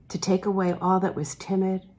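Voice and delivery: ordinary delivery, nothing marked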